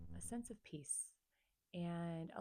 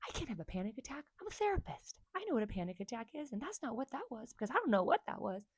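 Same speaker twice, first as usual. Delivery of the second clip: indignant denial; 5 semitones higher, 6 dB louder, faster than usual. Retold or imitated. Retold denial of panic attack.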